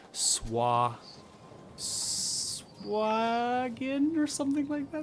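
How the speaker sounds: "Swagin" tried out high and slow, unsure of the name; hesitant try at "Swagin"; attempted pronunciation. Real, not acted. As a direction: sound out "Swagin" uncertainly, pitch rising. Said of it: "I'm gonna do my best to pronounce it".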